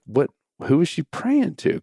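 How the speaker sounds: high, puzzled questioning; retold confusion. Dad questioning his upset son, high and puzzled. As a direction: ask as if thoroughly baffled. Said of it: "I'm now confused".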